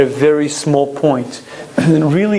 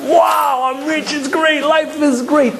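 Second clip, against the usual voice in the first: high, thrilled cry of a new millionaire; lottery winner's joy; 11 semitones higher than usual. Retold or imitated. Lottery winner bursting with joy, high and thrilled. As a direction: gush like you just won millions. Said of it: "The Rosh Yeshiva would often say".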